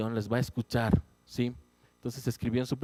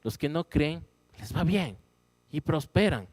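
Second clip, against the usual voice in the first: indignant complaint; 6 semitones higher, 4 dB louder than usual. Retold or imitated.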